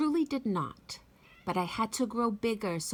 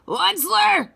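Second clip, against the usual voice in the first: higher, louder, croaking complaint; croaky angry Lorax; 7 semitones higher, 5 dB louder than usual. Acted. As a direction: rant through a smoggy throat. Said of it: "with a crufulous croak".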